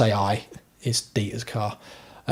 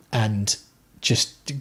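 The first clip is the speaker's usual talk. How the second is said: usual speaking voice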